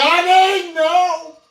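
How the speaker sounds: crying shout of protest. Real, not acted. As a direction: wail it in real distress. Child crying as lights go off.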